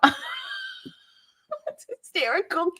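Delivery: laughing as the words come out. Real, not acted. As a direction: laugh through the words slowly. Reacting to a funny pet name.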